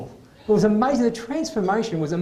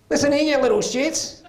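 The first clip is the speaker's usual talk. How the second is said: stressed mum snapping; 10 semitones higher than usual. Retold or imitated. Stressed mother threatening her kids at Christmas, high fed-up snap. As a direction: play the frazzled mother who's had enough. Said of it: "these words came out of her mouth".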